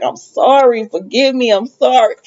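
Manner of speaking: normal talking voice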